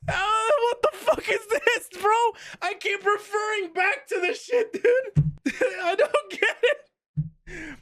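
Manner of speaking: very high squeal through real laughter; helpless, whining laughter. Real, not acted. Streamer baffled by the pudding definition, real tearful laughing wail. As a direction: wail it in disbelief while cracking up.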